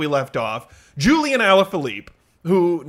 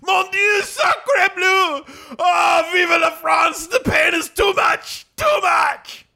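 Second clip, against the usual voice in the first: high anguished French wail; hammy French breakdown; french chef; 10 semitones higher, slower than usual. Acted. Overwrought French despair.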